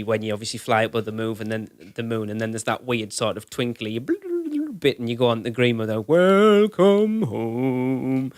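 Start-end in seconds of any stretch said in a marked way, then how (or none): 6.0-8.3 slow drawn-out welcome greeting; ride announcer voice. Retold or imitated. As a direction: stretch it out like a ride spiel.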